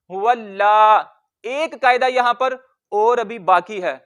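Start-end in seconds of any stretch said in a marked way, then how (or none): 0.1-1.1 हुवल्ला slowly, ल held, ला stretched; doubled and long lam. Shown as a demonstration. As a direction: stop on ल then lengthen ला. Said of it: "इसको लंबा करते हैं". (हुवल्ला, लाम पर रुककर लंबा)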